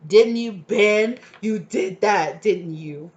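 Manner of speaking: slow, loud, accusing tease; hammed-up dramatic accusation. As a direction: draw it out like a stage accusation. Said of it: "I just got so dramatic".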